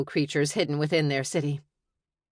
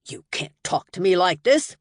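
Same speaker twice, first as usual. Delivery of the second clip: affronted; 3 semitones higher, 6 dB louder than usual. Acted. Wizard protests at security.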